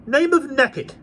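put-on high, loud surly boy voice; hideous boy. A narrator's reading. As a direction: snap it like a rude lad. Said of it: "said the boy".

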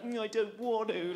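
put-on reluctant childish mumble; sulky toddler whine. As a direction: whine like a toddler refusing playgroup. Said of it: "like that".

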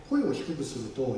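usual speaking voice

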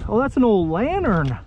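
ordinary delivery

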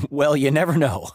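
usual speaking voice

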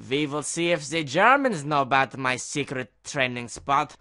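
hammed-up German accent; mock German accent. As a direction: put on a comic German accent. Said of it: "let's go to a German server".

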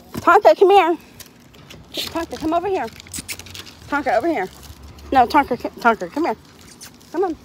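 ordinary talk

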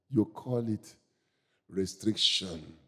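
soft, low, slowed preacher's voice; quiet, measured admonishment; hushed reproach. Real, not acted. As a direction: drop right down and let it land.

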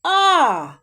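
the vowel А called out loud and high; loud teacher's model vowel; isolated vowel А. Shown as a demonstration. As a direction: sing out the lone А. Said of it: "Наступний звук".

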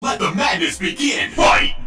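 deep dramatic announcer boom; booming fighting game announcer. Acted. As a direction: call the fight to start.

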